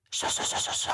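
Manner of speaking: soft, whispered shushing; hushing. A narrator's reading. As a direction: shush a crying baby under your breath. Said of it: "tyssede Elisabeth". (dæmpet, hviskende tyssen)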